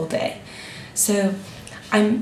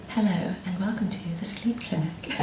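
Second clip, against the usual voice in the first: whispered asmr role play; 8 dB quieter than usual. Acted. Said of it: "like, an A S M R roleplay".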